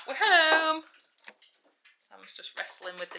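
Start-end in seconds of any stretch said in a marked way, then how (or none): 0.1-0.8 loud, high announcement; cheerful shout on coming home; calling out. Real, not acted. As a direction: call it into the house.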